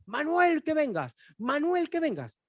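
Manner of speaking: high, loud calls of "Manuel"; a hint of a mother summoning her son; sing-song mom shouting from another room; mother calling out. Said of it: "la mamá que empieza".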